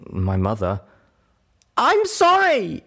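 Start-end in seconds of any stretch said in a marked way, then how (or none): 1.8-2.8 high, loud, drawn-out wail; tearful yell. A narrator's reading. Guilt-stricken narrator answers Calamity, a high tearful yell. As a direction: yell it back as tears burst out. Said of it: "I yelled back, bursting into tears".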